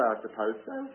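soft, uncertain mumble; unsure. Pretend. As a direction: say it doubtfully and quietly. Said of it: "You say".

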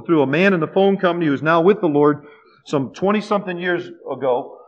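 usual speaking voice

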